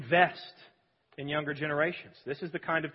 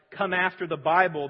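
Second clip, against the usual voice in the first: ordinary talk, nothing marked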